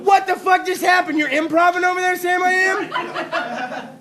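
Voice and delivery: high, incredulous shout; incredulous outrage. Real, not acted. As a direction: exclaim in comic disbelief. Comic outrage at audience improv.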